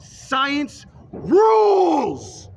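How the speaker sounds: science rules bellowed high and loud, drawn out; triumphant shout. Real, not acted. Ranting at believers, ends in a long triumphant yell. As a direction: belt it out like a victory cry. Rant climax, triumphant outburst.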